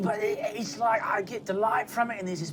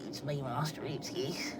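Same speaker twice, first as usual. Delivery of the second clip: whisper; 6 semitones lower, 8 dB quieter than usual. Acted. Mysterious self-introduction.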